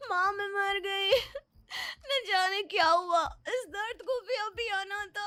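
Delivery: high, tearful wail of sudden stomach pain; crying out in pain. Acted. Daughter woken by stomach pain, crying high to her mother. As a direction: cry out to mom as the pain grips you. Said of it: "बहुत जोर-जोर से चिल्लाने लगती है".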